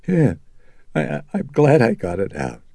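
high, stammering, tear-choked voice; tearful relief. A narrator's reading. Mother relieved after her sobbing outburst, tearful. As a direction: stumble into it, still teary. Woman just after crying, sniffling.

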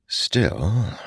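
ordinary delivery

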